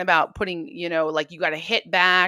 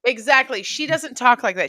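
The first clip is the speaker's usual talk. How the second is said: ordinary delivery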